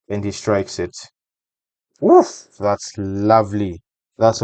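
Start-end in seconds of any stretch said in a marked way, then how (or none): 2.0-2.5 high, loud "woof" of admiration; sudden high-pitched burst at a great hit; impressed whoop. Real, not acted. Analyst watching a roaring strike, high impressed exclamation.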